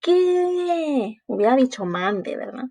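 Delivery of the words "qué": put-on high kid's what; high answer. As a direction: answer back like a child. Froggy responde a la llamada. (respuesta aguda de niño)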